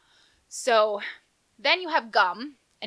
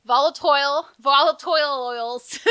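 giggling, stumbling over the word; laughing through it; 7 semitones higher, 10 dB louder than usual. Real, not acted. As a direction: laugh while saying it. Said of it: "Okay, I'm really tired".

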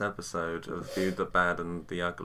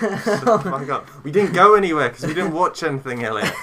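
angry shout; 8 semitones higher, 9 dB louder than usual. Pretend. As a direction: yell at him in fake outrage. Mock scolding co-host.

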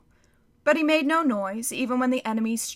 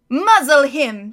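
slow, loud barked order; harsh command; 3 semitones higher, 11 dB louder, slower than usual. Acted. Witch commanding.

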